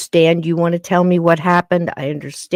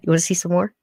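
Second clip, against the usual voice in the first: ordinary talk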